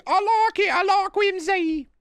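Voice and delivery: very high, drawn-out waif voice; squeaky character voice. Acted. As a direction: protest in a tiny put-on voice. Put-on squeaky voice rebuts accusation.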